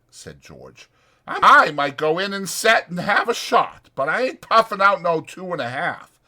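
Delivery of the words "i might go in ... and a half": higher, louder rough ranch hand. Acted. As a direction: speak it like a bunkhouse ranch hand. Voicing George.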